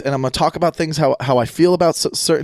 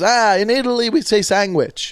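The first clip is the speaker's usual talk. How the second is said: higher, "sandwich" said as "sangwich"; Italian accent; 6 semitones higher than usual. Retold or imitated. Host imitating his Italian dad explaining, Italian accent. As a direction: explain it like a proud Italian father. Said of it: "He goes".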